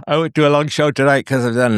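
words pulled through a yawn. Real, not acted. Tired host to Frank, yawning. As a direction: talk while yawning. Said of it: "sorry, I'm yawning".

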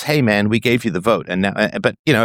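normal talking voice

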